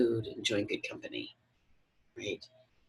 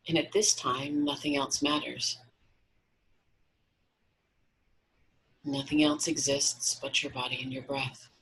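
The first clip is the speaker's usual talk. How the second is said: guided meditation. Acted. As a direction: guide them gently into relaxation, unhurried.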